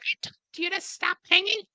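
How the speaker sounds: very high shrill-pitched mom voice; high mock mom nagging her son. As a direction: squeak it out like a scolding mother.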